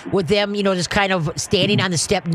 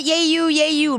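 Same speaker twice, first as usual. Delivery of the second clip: high, peppy cheer; cheerleader voice; 8 semitones higher than usual. Shown as a demonstration. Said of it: "cheerleader voice on".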